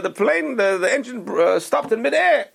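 high-pitched comic British gentleman; British accent. Retold or imitated. Two English gentlemen talking in the bar, hammed-up British accent. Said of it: "He puts on this British accent".

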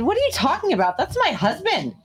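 high, startled "what are you talking about"; retold disbelief. Her own retold reply.

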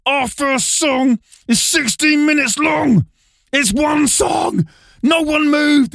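high, slow, drawn-out shout; mock outrage. Singer outraged at prankster over motionless crowd, slow mock shout. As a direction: protest in an exasperated high wail.

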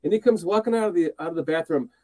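ordinary talk

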